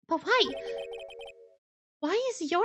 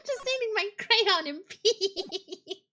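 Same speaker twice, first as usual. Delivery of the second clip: laughing; 3 semitones higher, much slower than usual. Real, not acted. Laughing through line.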